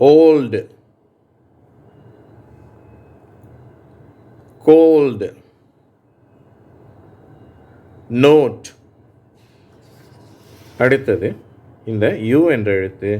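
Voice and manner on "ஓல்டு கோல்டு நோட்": ஓல்டு, கோல்டு, நோட் with a long round o, spaced apart; long o sound. Shown as a demonstration. Teacher modelling the o sound for students, clear. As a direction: stretch the o in ஓல்டு for repeating. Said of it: "ஓ என்ற உச்சரிப்பு".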